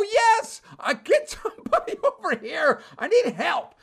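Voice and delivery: high, strained yell for help; retold desperate shout into the phone. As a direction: yell it at the top of your range. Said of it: "I'm literally yelling".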